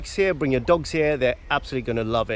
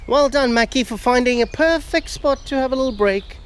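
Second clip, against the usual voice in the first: high, bright praise for the dog; 9 semitones higher than usual. Real, not acted. Praising his dog Mackie, high and cheery.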